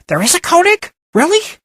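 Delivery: squeaky, very high and loud blurt; mouse on helium. Acted. Excited woman blurting at the name Koenig, very high squeak. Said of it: "a voice like a mouse on helium blurted out".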